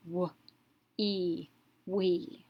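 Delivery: "w, e, we": each sound apart, then the whole word; sounded out, blended. Shown as a demonstration. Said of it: "this word we would pronounce".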